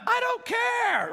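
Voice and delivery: high, slow, loud brush-off; child hollering from the water that he doesn't care; kid shouting back. Retold or imitated. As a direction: yell it back without looking up.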